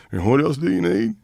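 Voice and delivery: nervous high-pitched version of the question; tight, high voice. Retold or imitated. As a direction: squeeze the throat and pitch it way up. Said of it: "it was very higher than normal".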